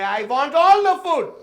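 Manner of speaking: slow, high, very loud Indian-accented demand. Shown as a demonstration. Teacher models a buffet demand for the class, loud Indian accent. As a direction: shout it slowly in an Indian accent. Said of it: "I want you to go in there and say".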